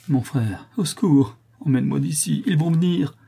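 high, panicked faint plea; failing voice. A narrator's reading. Delirious condemned sister to brother, failing plea. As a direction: beg for rescue as strength gives out. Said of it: "murmura Calebasse d'une voix défaillante".

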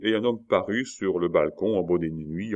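usual speaking voice

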